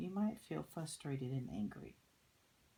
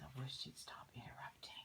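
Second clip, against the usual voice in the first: much softer unvoiced whisper; 10 dB quieter than usual. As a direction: whisper it, annoyed and hushed. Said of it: "He says".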